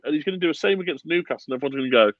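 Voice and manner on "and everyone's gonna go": ordinary talk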